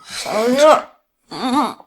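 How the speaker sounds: high whimpering moan; drugged, overheated. Acted. Drugged woman complaining of heat, high whimper. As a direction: whimper it as if burning up.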